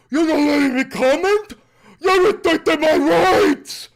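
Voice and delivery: loud, high mocking whine of an offended viewer; mock whiny chatter. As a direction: parody the chatter crying about rights.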